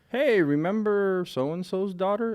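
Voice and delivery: imitating mom at dinner. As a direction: drag it out like a hinting mother. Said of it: "the mom will literally at dinner be like".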